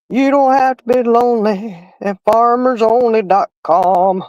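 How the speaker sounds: slow, imitated TV ad read; commercial jingle line. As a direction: mimic the old dating-site commercial, slowly. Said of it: "that commercial back in the day".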